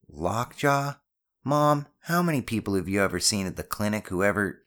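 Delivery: put-on boy scoffing at a parent's worry; skeptical son. Sceptical son argues back.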